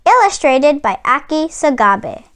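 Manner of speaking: ordinary delivery, nothing marked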